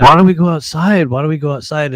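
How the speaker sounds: calling him outside, loud and pushy; loud challenge. Retold or imitated. To the man, inviting him outside loudly.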